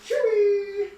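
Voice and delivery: bright yelp of a name across the room; excited call. Real, not acted. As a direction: shout the name up high to the dog. Calling out to the dog.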